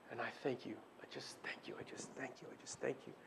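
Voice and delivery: breathy whispered thanks, barely voiced; whispered prayer. Real, not acted.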